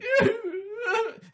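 shrill burst of laughter; high squealing laugh. Real, not acted. Laughing at crying idea.

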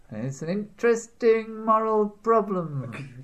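high, slow promo announcer; mock trailer voice-over.

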